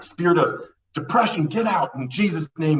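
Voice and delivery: imitating an elder casting out a demon; mock yelling elder. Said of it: "He was, like, yelling at him".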